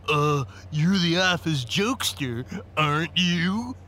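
slow, stuffy mocking imitation; smug stuffy impression, rising on "you". A narrator's reading. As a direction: mimic the lawyer in a stuffed-up, drawn-out voice. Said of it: "he said in a stuffy voice".

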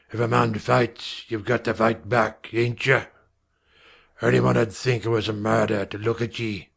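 a hint of a gruff Cockney father; rough father. A narrator's reading. Father ordering his wife, gruff and curt.

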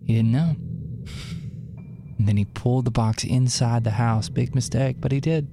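spooky storytelling. Acted. Telling his cohost a scary story to keep her awake, in a low spooky voice. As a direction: build suspense in a low voice.

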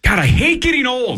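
high-pitched exasperated outburst. Real, not acted. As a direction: cry out in frustration at your own memory. Frustrated at forgotten film names.

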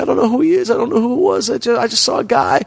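high mimicked weepy denial; put-on pleading falsetto; mock tearful girl. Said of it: "So she's crying".

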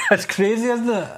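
real laugh breaking into the words. Real, not acted. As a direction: laugh it out in disbelief. Laughing at crazy neighbours.

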